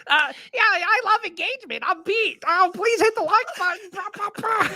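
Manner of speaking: high-pitched mock Pete voice; squeaky begging-for-likes influencer; Pete impression. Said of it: "you're getting warmer".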